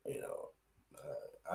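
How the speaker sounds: hushed aside; whisper. Real, not acted. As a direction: drop to a whisper. Aside about not a Bears fan.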